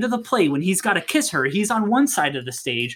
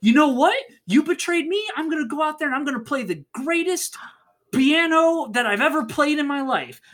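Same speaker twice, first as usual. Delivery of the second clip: high, heated mock comeback; acted outrage, rushing to a big boast; steamy anger; 10 semitones higher than usual. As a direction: fire it back like a jilted rival. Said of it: "angry, like".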